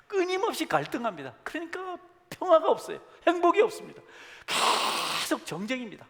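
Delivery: preacher's loud pained exclamation; impassioned outcry. Real, not acted. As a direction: cry out over the endless conflict. Preacher cries out about strife.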